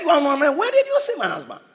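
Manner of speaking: a scoffing widow who won't believe him; incredulous widow. Retold or imitated.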